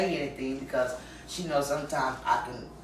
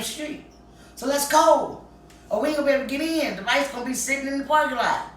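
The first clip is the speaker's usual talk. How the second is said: excited calling out; 8 semitones higher, 3 dB louder than usual. Retold or imitated. Calling friend to hurry out.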